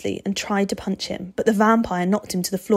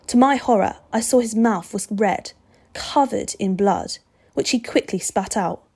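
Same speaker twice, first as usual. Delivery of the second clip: ordinary delivery, nothing marked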